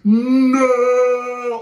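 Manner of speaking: long, loud drawn-out cry of despair; Darth Vader. Retold or imitated. Film fan mocking the movie's ending, drawn-out mock wail. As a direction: hold the wail on one level note. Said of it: "Darth Vader going".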